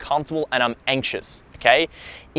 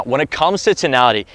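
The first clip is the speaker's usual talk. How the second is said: ordinary talk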